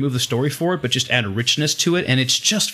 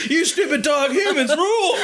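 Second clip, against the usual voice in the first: much higher laughing yell of a jerk driver; cackling high-pitched taunt at a dog; mock jerk driver. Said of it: "I honk at it and be like".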